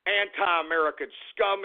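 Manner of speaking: usual speaking voice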